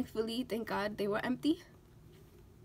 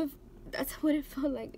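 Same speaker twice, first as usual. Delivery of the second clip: normal talking voice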